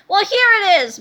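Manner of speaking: loud, proud announcing voice; triumphant. Acted. Mr. Melvin unveiling the time machine to the boys, triumphant. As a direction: present it like a grand reveal. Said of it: "Mister Melvin interrupted triumphantly".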